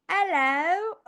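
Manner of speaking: a high, loud British hello; mock British accent. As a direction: chirp it back in a put-on British accent. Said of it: "my British accent".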